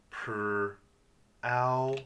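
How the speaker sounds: "per" held, then a long "ow"; sounded out. Shown as a demonstration. Teacher sounding out the R vowel, segmented. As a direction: split "per, ow" with a gap. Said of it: "There's that R vowel sound".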